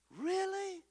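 high, soft, incredulous really; mock disbelief. Real, not acted. Preacher scoffing at critics, high mock surprise. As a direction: squeak out a sarcastic really. Mocking a claim.

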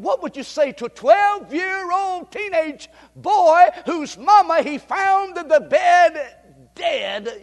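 preacher pleading; preaching with grief. Acted. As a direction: press the question home slowly.

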